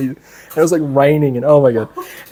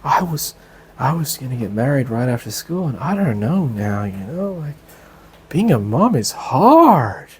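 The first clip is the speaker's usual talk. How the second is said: softly voiced, hesitant new mother; lightly acted, quiet halting admission; imitated weary mom; 3 semitones lower, 4 dB quieter than usual. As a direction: mimic her faltering, then draw out the last word. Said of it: "the other lady said".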